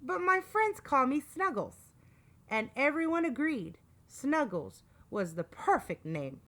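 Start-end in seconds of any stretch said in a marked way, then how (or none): 0.0-1.9 high, cute little monster voice; giggly monster. A narrator's reading. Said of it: "The monster giggled".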